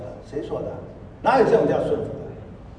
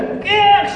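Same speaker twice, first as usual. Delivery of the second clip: high, loud grunt of squeezing hard; effortful squeeze grunt; 13 semitones higher, 6 dB louder than usual. Shown as a demonstration. Wife teaching husband a very forceful hug, straining grunt. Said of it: "要很用力，".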